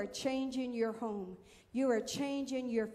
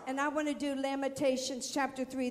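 ordinary talk

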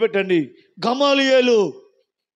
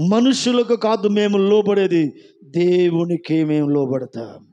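much lower, slow and grave; preacher quoting scripture, deep and slow; deep solemn quote; 7 semitones lower, slower than usual. Shown as a demonstration. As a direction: drop the voice low and slow for scripture. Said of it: "ఒక మాట రాశాడు".

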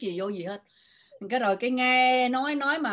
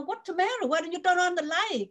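high concerned question; 9 semitones higher than usual. Retold or imitated. Friend walks in, finds her crying.

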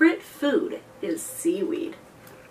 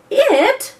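much higher, louder indignant "It?"; offended mermaid; 13 semitones higher, 10 dB louder than usual. Acted. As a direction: bristle at being called an it. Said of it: "the something replied".

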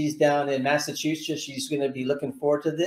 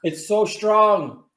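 talking while chewing; chewing candy; 2 semitones higher, much slower than usual. Real, not acted. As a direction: mouth partly full. Seen 0: Both hosts eat candy pieces; speaker unclear, mouths chewing throughout.

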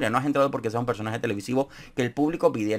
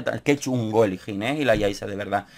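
ordinary talk

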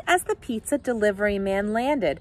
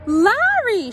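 high, loud, delighted hail; sudden calling out; 13 semitones higher, 6 dB louder than usual. A narrator's reading. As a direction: call out suddenly to an old friend. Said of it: "she called out suddenly".

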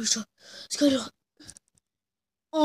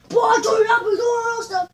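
dad scolding; 6 semitones higher than usual. Pretend. Dad scolding son in play.

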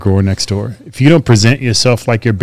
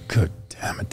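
whispered thought; 2 semitones lower, 13 dB quieter than usual. Retold or imitated. Retelling his inner thoughts on stage.

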